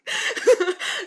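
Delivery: high, loud burst of laughter. Real, not acted. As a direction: crack up out loud. 讲妈妈坏话笑出来. (突然大笑出声)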